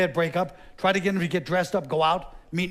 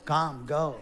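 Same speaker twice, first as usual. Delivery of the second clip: usual speaking voice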